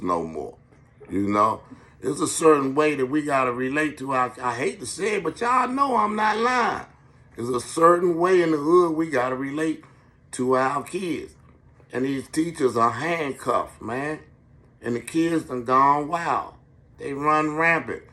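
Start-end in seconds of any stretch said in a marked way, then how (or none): 4.4-6.9 sudden high-pitched shout; shrieking that y'all know it's true. Real, not acted. Comic outburst to the crowd. 7.3-18.0 heated rant. Real, not acted.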